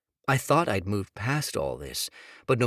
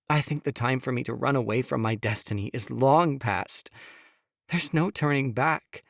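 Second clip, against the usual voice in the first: higher, pleading woman's voice; Sophia pleading; 4 semitones higher than usual. Acted. Wife begging husband to hear her, higher pleading. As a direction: plead with him, voice pitched up.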